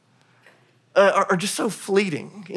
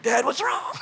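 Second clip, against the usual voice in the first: put-on high, softer little-kid voice; child's voice; 7 semitones higher, 6 dB quieter than usual. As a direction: imitate a worried young son. Voices his startled sons.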